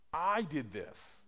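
slow, high, drawn-out I; stress on I. Retold or imitated. Preacher mimicking believers thinking about me, stress on I. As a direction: hit I hard and stretch it.

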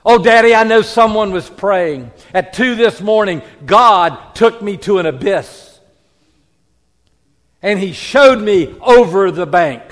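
loud, pleading daughter's voice; urgent pleading. Retold or imitated. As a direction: beg her father, voice straining. Daughter recounts her vision loudly.

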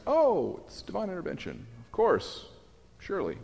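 sarcastic, mock awe, high then soft; sneering mock reverence; mock sarcasm. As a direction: scoff at the idea with fake wonder. Mocking the Greeks' belief.

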